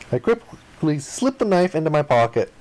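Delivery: usual speaking voice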